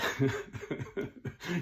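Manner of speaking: breathy, voiceless laugh after the punchline; amused laughing to oneself. Real, not acted. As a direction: chuckle at your own joke. Laughs at own joke.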